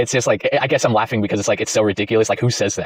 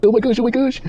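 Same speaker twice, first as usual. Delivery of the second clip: much higher squealing oh my gosh; much higher mock-excited squeal; mock giddy squeal; 13 semitones higher than usual. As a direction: gush like a thrilled fan. Mocking her excitement.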